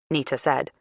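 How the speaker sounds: ordinary delivery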